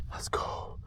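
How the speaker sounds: soft whispered celebration; whispered cheer. Real, not acted. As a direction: whisper it in triumph. Quiet celebration.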